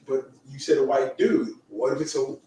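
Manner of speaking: normal talking voice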